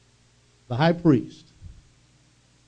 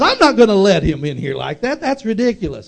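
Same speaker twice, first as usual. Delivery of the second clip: indignant manager; 6 semitones higher, 7 dB louder than usual. Retold or imitated.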